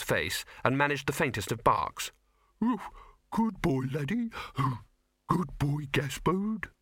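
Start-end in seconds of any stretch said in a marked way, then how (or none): 2.6-6.7 put-on high, childlike dog; earnest little dog voice; simple dog voice. A narrator's reading. Dying dog Laddie speaks.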